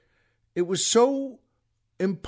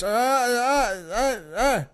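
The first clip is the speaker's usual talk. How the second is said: high-pitched imitation of an angry clerk; mock angry babble; 3 semitones higher, slower than usual. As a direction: scold in nonsense sounds. Imitates angry clerk pointing at sign.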